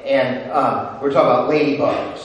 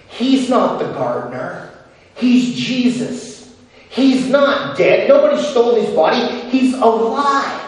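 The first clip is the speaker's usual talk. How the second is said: louder and higher, building joy; rising excitement; 7 semitones higher, 4 dB louder than usual. Acted. Preacher proclaiming resurrection, building to joyful proclaim. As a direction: proclaim it, each line bigger.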